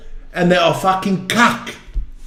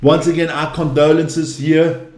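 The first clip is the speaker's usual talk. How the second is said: lower, gentle sympathy for a grieving friend; sincere condolences; 6 semitones lower than usual. Real, not acted. Host to grieving Eddie, lower and sincere sympathy. Condolences for his late sister.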